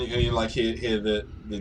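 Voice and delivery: normal talking voice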